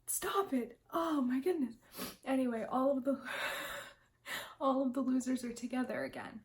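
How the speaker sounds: overcome, half laughing and half crying; real tears breaking through; choked up crying. Real, not acted. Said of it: "I'm actually crying".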